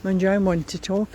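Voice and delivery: normal talking voice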